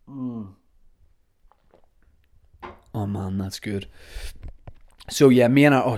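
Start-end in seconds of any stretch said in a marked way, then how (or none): 0.1-3.9 soft, just after swallowing tea; after a sip of tea. Real, not acted. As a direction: savour the tea quietly. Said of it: "let me take a sip of tea".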